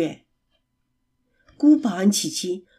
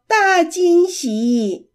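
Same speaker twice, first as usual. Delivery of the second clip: giggling announcement; 7 semitones higher, much slower than usual. Acted. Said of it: "琪琪咯咯咯地笑着说".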